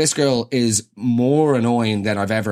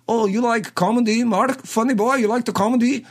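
a higher, teasing put-on Dutch accent; mock Dutch-tinged accent; 8 semitones higher than usual. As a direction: tease the Glasgow lad who fakes a Dutch accent. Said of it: "Why are you speaking like".

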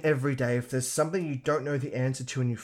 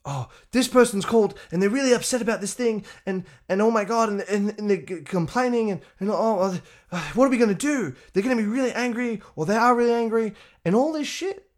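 high, rushed fretful coworker; mocking panicky coworker; 7 semitones higher than usual. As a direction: mimic a coworker fretting over an angry client. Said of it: "people would come to me".

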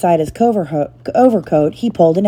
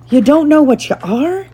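bewildered; 9 semitones higher than usual. A narrator's reading. Hagrid bewildered.

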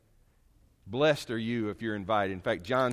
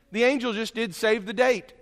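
ordinary talk, nothing marked

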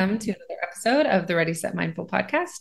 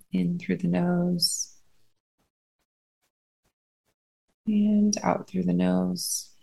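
unhurried, steady phrases, spaced out to let them breathe; guided meditation. Acted.

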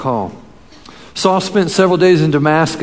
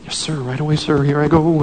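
eager, hurried yes-sir obedience; nervous eager young recruit. Retold or imitated. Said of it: "Ananias is kinda like".

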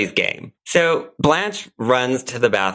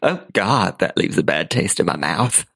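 Blanche Devereaux; 2 semitones higher than usual. Acted. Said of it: "In the role of Blanche Devereaux".

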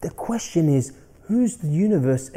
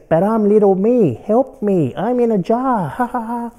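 trapped in a jar; 6 semitones higher, 4 dB louder than usual. Pretend. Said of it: "I don't know who I'm, uh, channeling".

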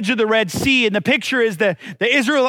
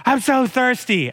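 drawn-out whiny child imitation; whiny kids. Kids pestering their parent for food and drink, whining. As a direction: whine it slowly like a needy kid. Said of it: "My kids are".

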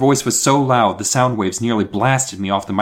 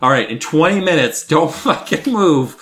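bossy scientist; 5 semitones higher, 4 dB louder than usual. Retold or imitated. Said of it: "and said, like".

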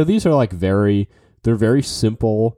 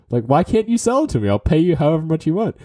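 higher, fast, insistent retold protest; insistent buyer; 8 semitones higher, faster than usual. Buyer pushing the young artist to sell, insistent. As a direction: press the seller in a quick, raised voice.

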